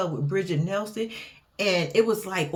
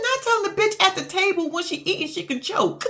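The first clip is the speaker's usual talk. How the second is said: sassy retort; 9 semitones higher, faster than usual. Retold or imitated. Vlogger's own retort quoted.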